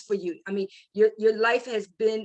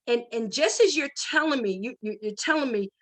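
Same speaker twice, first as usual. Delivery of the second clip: ordinary talk, nothing marked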